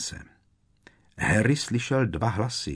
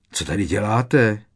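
louder, startled challenge; abrupt, mildly startled demand; fired-off question; 6 dB louder than usual. A narrator's reading. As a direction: fire the question out. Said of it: "vypálili svou otázku".

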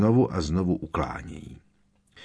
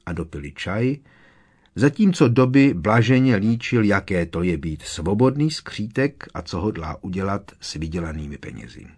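ordinary delivery, nothing marked

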